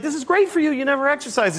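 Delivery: high, cheerful dog voice; high-pitched, delighted pet; happily oblivious dog. Retold or imitated. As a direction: chirp it as a dog enjoying the run. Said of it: "she's just enjoying it".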